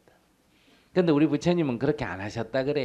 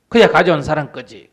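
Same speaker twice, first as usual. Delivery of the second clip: curt answer; 5 semitones higher, 15 dB louder than usual. Retold or imitated.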